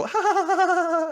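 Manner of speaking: very high, fake ha-ha laugh; spelled-out mock laughter, high; imitated group laugh. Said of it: "and they laugh".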